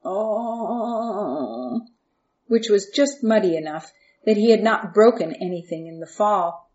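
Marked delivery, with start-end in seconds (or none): 0.0-1.8 moan. A narrator's reading. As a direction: groan softly from the bottom of a well. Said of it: "Joseph just moaned on the bottom".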